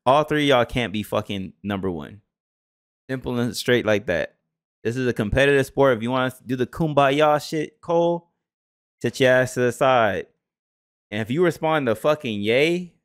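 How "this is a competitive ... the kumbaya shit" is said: ordinary talk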